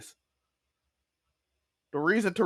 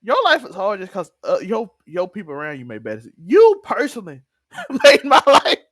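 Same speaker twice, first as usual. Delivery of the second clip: hammed-up outrage, laughing through it; retold indignant outburst; 6 dB louder than usual.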